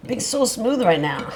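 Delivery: slow, hammed-up suave drawl; mock smooth. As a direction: play it as a cheesy charmer. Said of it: "nope, you are not".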